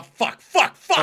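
loud, high yelps of pain; pained shout. Real, not acted. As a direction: yell out from the chili burn. Chili burn hits.

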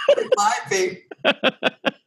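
high laughing squeal. Real, not acted. Laughing about moaning.

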